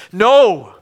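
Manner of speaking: loud single outburst; firm, loud rebuff; defiant refusal. Acted. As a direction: throw the accusation back forcefully. Answering back to the devil.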